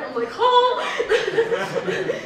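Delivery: a drawn-out nervous oh through a laugh; real nerves, gasping out a laughing oh. Real, not acted. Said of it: "I need to breathe".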